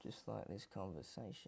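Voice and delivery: muttered dig at the guest; snide aside. Acted. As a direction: mutter it to yourself.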